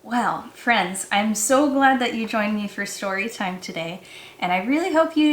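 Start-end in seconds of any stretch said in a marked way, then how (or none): none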